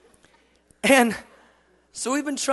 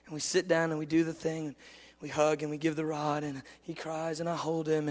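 ordinary delivery

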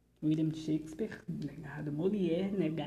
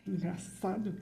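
usual speaking voice

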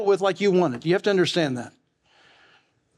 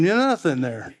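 usual speaking voice

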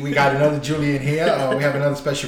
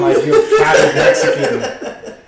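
high, slow, laughing through the jab; cracking up while he teases; 4 dB louder, much slower than usual. Real, not acted. Teasing a friend's accent, laughing hard.

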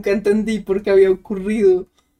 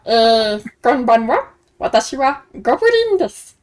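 goblin. Acted. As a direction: play a giddy little goblin doing the raffle. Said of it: "está hablando en Goblin". (voz de goblin chillona)

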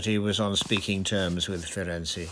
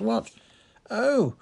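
high startled Oh!; sudden realisation; 13 semitones higher, 4 dB louder than usual. Acted. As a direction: jump up in pitch on Oh!. Lavender suddenly understands.

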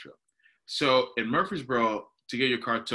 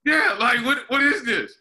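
higher and louder, baffled; exasperated; 12 semitones higher, 6 dB louder than usual. Retold or imitated. Telling his buddy about the tow lot, loud baffled exasperation. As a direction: throw up your hands in disbelief.

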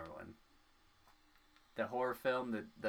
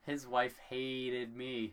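"hated" drawn out slowly; slow, leaning on "hated"; emphatic complaint; 3 semitones higher, much slower than usual. Real, not acted. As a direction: stretch the word "hated" for emphasis. Emphatic complaint about teacher's wife.

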